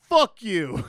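loud, high movie-quote outburst; hammed-up hostile comeback; mock angry retort. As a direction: fire it back as a rude punchline. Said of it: "the way you did the accent".

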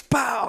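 loud, high-pitched "bow" impact noise; mimicked wipeout bang; imitated crash impact. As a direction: punch it out like a sudden slam.

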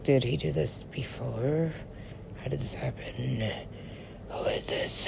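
slow, very soft questions fading into a whisper; barely-voiced, drawn-out puzzlement; hushed wondering. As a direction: murmur it slowly to yourself, losing voice. Quotes own hushed wondering.

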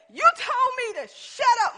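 high, loud indignant retort; indignant husband. Retold or imitated. Husband snapping back at his wife in the car, loud and indignant. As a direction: throw it back at her, offended.

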